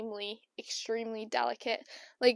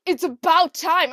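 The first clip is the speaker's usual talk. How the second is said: dad's gloating; 5 semitones higher, 11 dB louder than usual. Retold or imitated. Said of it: "my dad laughed".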